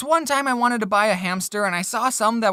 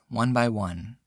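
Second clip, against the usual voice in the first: mock grief; 10 semitones lower, 5 dB quieter, slower than usual. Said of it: "I'm getting really emotional".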